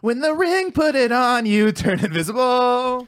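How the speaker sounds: high, slow hammed-up voice; mock epic voice. Host mocking Lord of the Rings lines, high and slow. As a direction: ham it up like a fantasy hero. S0 mocks fantasy movie voice.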